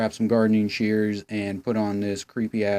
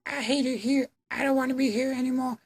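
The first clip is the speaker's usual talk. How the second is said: put-on very high, soft, whiny girl voice; a hammed-up bored teen complaining; whiny mock Emma; 4 dB quieter than usual. Mocking Emma whining about her safe town. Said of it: "Emma's like".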